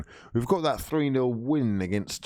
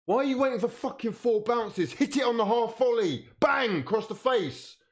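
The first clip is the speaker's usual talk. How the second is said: exasperated shout; 10 semitones higher than usual. Real, not acted. Fan shouting at players to shoot first time, exasperated yell. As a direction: bark orders at the players.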